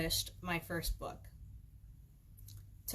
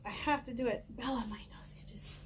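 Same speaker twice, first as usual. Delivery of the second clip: whispered aside to the dog; 4 semitones higher, 3 dB quieter than usual. Real, not acted. Speaker whispering to her dog Bella, whispered. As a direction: drop to a whisper mid-talk.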